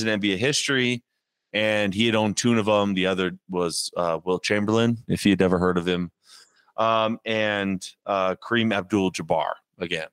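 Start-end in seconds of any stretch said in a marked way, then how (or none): none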